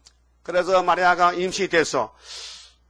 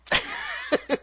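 높은 웃음소리; 3 dB quieter than usual. Real, not acted. 가룟 유다 이야기 중 웃음.

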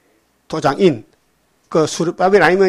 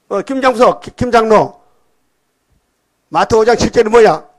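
raised, louder preacher's rebuke; scolding; 6 semitones higher, 7 dB louder than usual. Retold or imitated. Blind revivalist quizzing an elder on scripture, scolding. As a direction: fire the demand out high and loud. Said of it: "대답 못하면 막 야단쳐".

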